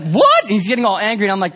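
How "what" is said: high, loud shocked yelp; shocked what. Retold or imitated. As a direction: blurt it in disbelief. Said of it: "He's like".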